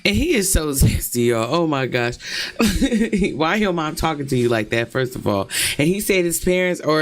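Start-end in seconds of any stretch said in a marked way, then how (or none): 2.2-3.3 spontaneous giggle; amused laugh. Real, not acted. Listener reacting to the mom's cussing, amused laugh. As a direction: laugh at the outrageous story.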